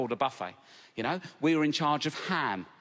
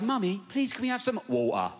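put-on lightly posh little kid voice, raised pitch; a hint of a well-spoken child asking nicely; 8 semitones higher than usual. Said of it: "They speak half like me and half like the other family".

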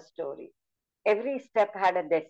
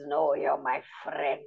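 low, slow gentle lion voice; big lion reassuring the mouse, low and slow; kind lion; 4 semitones lower, 3 dB quieter than usual. Shown as a demonstration. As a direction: rumble it softly like a friendly lion. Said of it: "So I changed the voice like this".

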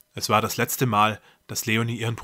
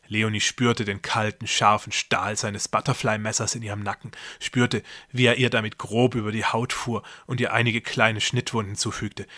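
plain reading voice

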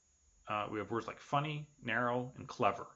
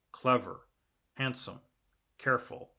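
usual speaking voice

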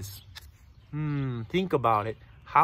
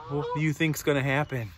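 ordinary delivery

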